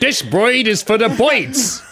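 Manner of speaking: high, slow, hammed-up American accent; cartoon American accent. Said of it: "On The Simpsons".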